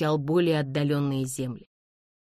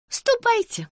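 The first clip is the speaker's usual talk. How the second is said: very high, curt order; imperious command; 4 dB louder, slower than usual. A narrator's reading. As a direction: dismiss the servant haughtily. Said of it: "изрекла мисс Ингрэм". (властно, высоким голосом)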